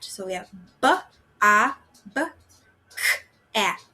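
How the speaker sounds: bobcat split into b, a, b, k, at; teacher segmenting bobcat for the class; sounds segmented. Shown as a demonstration. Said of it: "if we're blending it".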